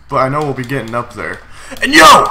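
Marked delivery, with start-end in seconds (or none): 1.8-2.3 sudden high, loud yell; excited shout. Real, not acted. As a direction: burst out with a loud yo. Sudden excitement during battle.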